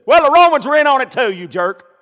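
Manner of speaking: loud, high mock retort to Peter; a hammed-up loud comeback. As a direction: shout it back as the indignant apostle. Preacher voices Peter retorting.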